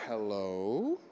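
low HELLO drawn out, rising slowly at the end; imitated stern hello. Guest imitating how host answered the phone, stern low hello. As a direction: drag out "Hello" low, then lift it questioningly. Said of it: "Do you know how you answer the phone?".

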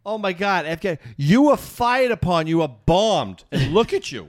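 retold exasperated lecture; incredulous scolding. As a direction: hammer each point in disbelief. Retold scolding of panicked friend.

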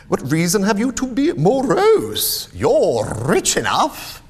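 high, loud teasing challenge; cheerful retort. Acted. Nephew teases his rich uncle, cheerfully challenging.

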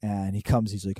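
ordinary talk

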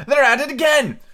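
high, loud messenger's yell; full-voiced shout. A narrator's reading. Messenger bellowing the news at the king, loud high shout. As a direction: shout it out at the top of your voice. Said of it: "shouted at the top of his voice".

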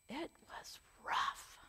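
whispered. Real, not acted. Speaker confiding to the congregation, whispered. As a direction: breathe it out unvoiced.

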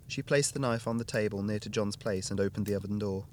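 ordinary delivery, nothing marked

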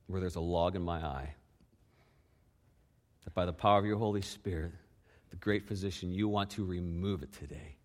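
soft, hushed prayer voice. Acted. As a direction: pray quietly over the room. Leads a quiet prayer.

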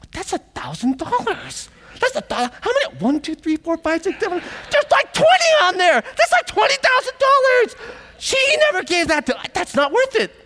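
squeaky high voice building from stunned to loud screech; outraged disbelief. Acted. Kids counting twenty thousand dollars on the lei, outraged disbelief.

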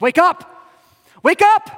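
high, loud frantic shouting; frantic yell. Retold or imitated. Servant waking Elisha in panic, high frantic yell. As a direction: yell him awake in a panic. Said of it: "so he freaks out".

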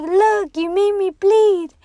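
mock an outraged yell; retold screaming. Injured man screaming at the runner who hit him, retold as a yell. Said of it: "He kept screaming at me".

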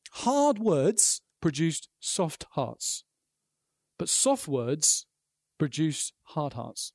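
very slow, each word set down; a slow solemn quote from the pulpit; slow weighty quote. Retold or imitated. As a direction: let the quote land slowly, word by word. Quoting Driscoll, slowed right down.

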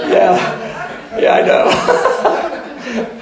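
high, loud, drawn out through laughter; comic laughing reply. Retold or imitated. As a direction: crack up while playing along. Comic reply to the weeping client.

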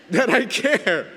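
correcting himself with a high, loud laugh. Real, not acted. Pastor laughing at his slip about not caring. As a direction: laugh through the words, caught out by your own joke.

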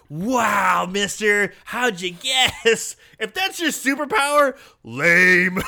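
put-on sarcastic kid voice. Kid mocking the ice cream man.

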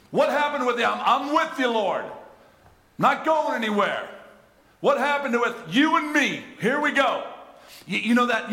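high, loud, urgent preaching; fiery preacher challenging the crowd; preaching at full force. Real, not acted. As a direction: press the congregation hard. Impassioned preaching, mock vows.

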